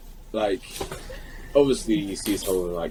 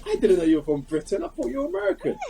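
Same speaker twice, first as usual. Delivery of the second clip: high-pitched imitation of a starstruck fan; excited fan. Gym fan learning he's from Britain, high excited squeal. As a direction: squeal it like a fan who can't believe it.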